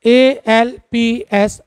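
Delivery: ए एल पी एस spelled out slowly, letter by letter; spelling aloud. Shown as a demonstration. Teacher spelling Alps slowly for students. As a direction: spell ए एल पी एस with a pause on each letter. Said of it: "Alps पर्वत".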